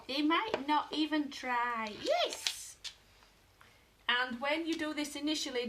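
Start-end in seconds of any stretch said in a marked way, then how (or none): 2.0-2.8 very high yes; excited yes. Real, not acted. Owner cheering her dog, squealed yes.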